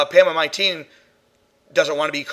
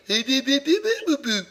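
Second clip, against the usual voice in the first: high babbling "bee-bee-boo-boo"; playful spirit's baby gibberish; mock baby babble; 9 semitones higher than usual. Prankster spirit messing with investigators, high mock baby babble. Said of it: "I'd probably just do it in purpose".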